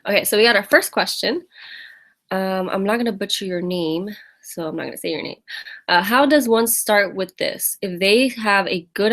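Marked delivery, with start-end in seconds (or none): none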